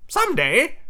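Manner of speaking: very high sidekick voice; high-pitched henchman; Smee. Acted. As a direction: pipe up high. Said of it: "said Smee".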